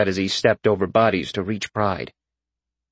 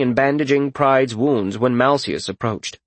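plain reading voice

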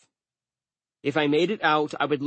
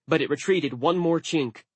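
usual speaking voice

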